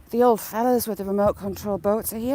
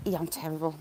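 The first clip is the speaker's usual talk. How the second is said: ordinary delivery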